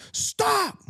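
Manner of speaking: sharp high mock cop yell; retold yell. Stranger yelling to stop a bar fight, sharp high call. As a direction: bark it high like a cop breaking it up. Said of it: "somebody yelled".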